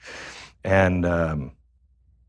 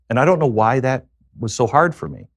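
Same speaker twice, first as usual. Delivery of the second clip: normal talking voice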